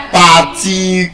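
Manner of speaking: "particular" with full vowels in every syllable; Nigerian pronunciation. Shown as a demonstration. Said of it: "Nigerians, what do we say? We say".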